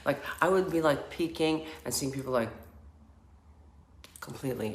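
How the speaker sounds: ordinary delivery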